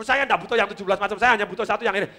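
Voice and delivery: quick, impatient customer cutting in; fast-talking customer. Acted. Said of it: "orang yang ngomongnya cepet". (pelanggan tak sabar, bicara cepat)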